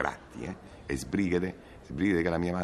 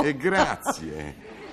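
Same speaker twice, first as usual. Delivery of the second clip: mock indignant; much slower than usual. Sarcastic comeback, very high.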